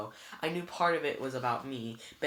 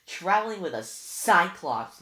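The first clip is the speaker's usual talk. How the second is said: louder, slow scornful tut; chiding; 5 semitones higher, 9 dB louder, slower than usual. A narrator's reading. Luke mocking Annabeth for her company, chiding. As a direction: scold her with disdain. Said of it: "Luke chided".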